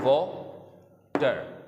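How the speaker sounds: Water said very slowly as two separate beats, वा-टर; teacher beating out water syllable by syllable. Shown as a demonstration. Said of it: "देखिएगा ज़रा कि ये किस तरह से beat करता है".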